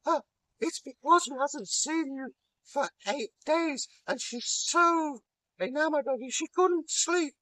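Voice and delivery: very high fluttery maid voice; Loki, dressed as the maid, makes excuses to the giant in a very high voice; Loki in falsetto maid voice. Acted. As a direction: simper like a coy bridesmaid.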